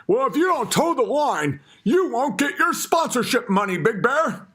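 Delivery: high mocking sponsor voice. Mocking the sponsors who pull his money, high mocking voice. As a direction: sneer it up high like a smug sponsor. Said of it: "They're like".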